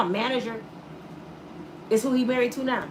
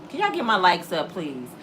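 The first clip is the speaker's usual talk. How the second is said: normal talking voice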